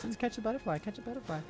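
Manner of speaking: high, soft singsong voice; silly singsong. Pretend. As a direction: sing it out like a carefree kid. Playful singsong while dodging.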